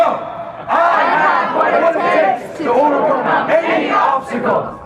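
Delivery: high, loud, slow battle cry; rally the crowd to shout it; shouted affirmation. Shown as a demonstration.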